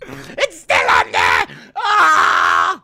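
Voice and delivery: loud, hysterical wailing; hammed-up shrieking distress; mock panic. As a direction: wail it like a stagehand in despair. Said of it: "What I just reenacted there".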